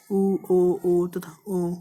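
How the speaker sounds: उ and ओ sounded out carefully one by one; careful teacher demo of उ and ओ; vowel demo. Shown as a demonstration. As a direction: model each vowel उ and ओ clearly for the class. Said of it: "जैसे ओ".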